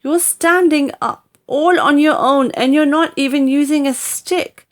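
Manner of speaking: put-on a boy staring at his grandmother standing; boy's voice, amazed. A narrator's reading.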